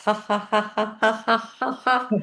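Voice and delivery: light ha ha while turning taps; shower laughter; playful laugh. Shown as a demonstration. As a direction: laugh along. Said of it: "turn on the taps".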